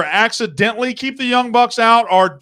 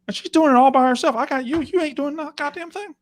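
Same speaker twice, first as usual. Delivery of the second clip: high-pitched, exasperated mock rant; hammed-up indignation at a slacking producer; mock scolding; 8 semitones higher than usual. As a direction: berate the producer off to the side, voice pitched up. Mock quarrel with his producer.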